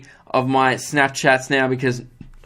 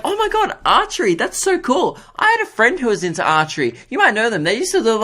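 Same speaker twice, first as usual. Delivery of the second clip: much higher, over-the-top mock enthusiasm; gushing shop assistant; 6 semitones higher than usual. Apple store assistant gushing over archery, much higher mock enthusiasm. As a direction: ham up a gushing salesgirl. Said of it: "And she's like".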